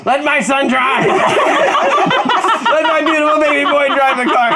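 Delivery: high, loud, laughing shout, slow at the end; excited laughing cheer. Acted. Proud father cheering his son on, laughing loudly. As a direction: cheer the kid on while laughing hard.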